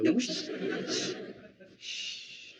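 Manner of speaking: firm shushes to cut someone off; repeated shushing. Acted. Friend silencing his overexcited roommate, repeated shushing.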